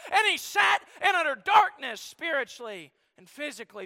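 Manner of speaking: loud, high preaching climax; preaching at full force. Acted. Preacher proclaiming Christ's innocence to the congregation, loud and high.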